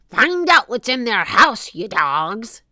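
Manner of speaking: loud, rough-mannered pirate order; bossy pirate command; pirate captain. A narrator's reading. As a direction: order the crew about loudly. Pirate captain orders his crew.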